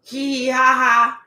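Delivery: spoken fake giggle; put-on, sing-out "hee hee ha ha"; mock laughter. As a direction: imitate their laughing in spelled-out syllables. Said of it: "they was laughing with".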